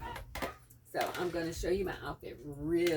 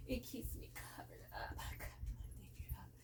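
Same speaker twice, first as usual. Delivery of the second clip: whispered aside; 5 semitones higher, 10 dB quieter than usual. Real, not acted. Vlogger to her viewers, playful whispered aside. Soft aside about the caftan.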